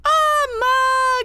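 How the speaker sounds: sudden excited shout. Real, not acted. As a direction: yelp of delight. New sub alert.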